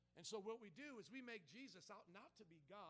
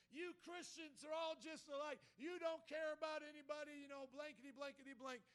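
loud, high tirade, imitating a furious man; angry yelling; 7 semitones higher, 8 dB louder than usual. Homeless man turns on the pastor in public, yelling angrily. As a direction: imitate his frustrated outburst at full volume. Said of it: "his real anger up and his real frustration".

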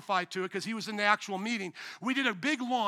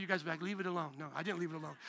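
much softer, lower, mimicking the crowd; soft mock reaction; 5 semitones lower, 10 dB quieter, faster than usual. Pastor mimicking congregation's reaction, soft and low. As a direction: hush it down as if the crowd whispers.

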